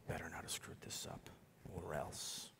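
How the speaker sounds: whispered low threat; menacing whisper. Retold or imitated. Teen magician whispering a threat to the client. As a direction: hiss the threat under your breath.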